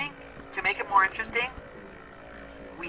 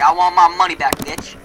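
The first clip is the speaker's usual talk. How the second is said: loud, rude demand; demanding shout; 8 dB louder than usual. Retold or imitated. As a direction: yell it at the delivery guy. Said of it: "Be all like".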